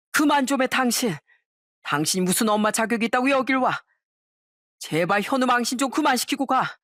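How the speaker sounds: a touch of angry pleading; exasperated scolding. A narrator's reading. Husband scolds ex-wife before in-laws.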